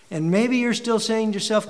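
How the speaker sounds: ordinary talk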